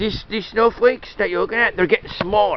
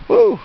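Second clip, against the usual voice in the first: excited whoop; 12 semitones higher, 7 dB louder than usual. Real, not acted. Excited cry in the snow.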